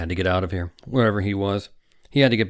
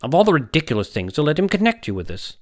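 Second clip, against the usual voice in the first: higher and louder, scolding; sharp exasperation; 8 semitones higher, 6 dB louder than usual. Acted. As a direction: scold an older colleague in disbelief. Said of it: "said Doctor Manelli sharply".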